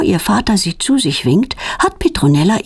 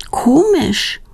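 high-pitched puzzlement; puzzled wondering; 8 semitones higher than usual. A narrator's reading. Petronella hears no sound from the crickets, puzzled. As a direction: wonder aloud, pitched well up. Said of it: "wundert sich Petronella".

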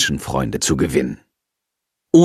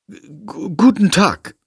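flustered; 7 semitones higher, slower than usual. A narrator's reading.

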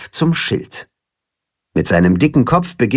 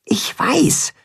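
whisper; 12 semitones higher than usual. A narrator's reading. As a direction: whisper it to the mole. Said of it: "flüstert Timme".

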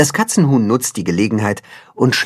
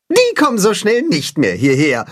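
higher, gleeful voice; pleased; 8 semitones higher than usual. A narrator's reading. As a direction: say it with satisfied glee. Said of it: "freut sich Timme".

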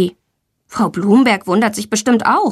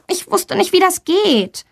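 embarrassed; 9 semitones higher than usual. Acted. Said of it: "schildert ihr verlegen".

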